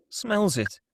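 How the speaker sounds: ordinary talk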